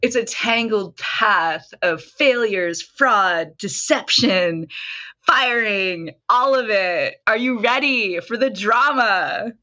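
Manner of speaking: mock-dramatic. Guest teasing host with the backstory, mock-dramatic. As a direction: hype your backstory like a lurid saga. Teases own backstory as melodrama.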